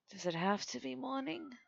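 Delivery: groggy captain. Acted. Captain just woken by her crewmate, groggy and soft. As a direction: mumble a reluctant waking question, softly.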